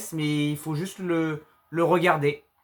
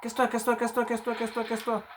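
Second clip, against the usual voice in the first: panicking gamer; 6 semitones higher, faster than usual. Real, not acted. Chasing monster away, tense gamer.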